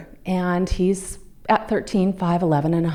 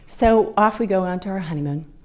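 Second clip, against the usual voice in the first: ordinary talk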